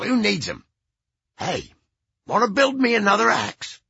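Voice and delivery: a hint of a grumpy old musician; cranky old rocker. Iggy talking to Robin.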